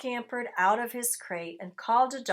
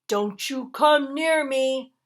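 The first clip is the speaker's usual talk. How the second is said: slow, loud snarl, higher pitched; 5 semitones higher, 8 dB louder than usual. A narrator's reading. Grouchy dog warning the rat away, snarling. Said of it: "snarled Dog".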